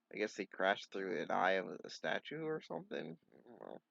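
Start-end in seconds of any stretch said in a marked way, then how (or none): none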